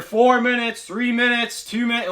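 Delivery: high-pitched, loud announcer call; loudspeaker countdown. Retold or imitated. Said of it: "like, over the loudspeaker".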